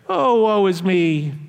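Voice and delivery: slow, hammed-up woe-is-me whine; a mocking moan at the pity party; mock self-pity. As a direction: play the pity party for laughs. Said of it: "have a pity party".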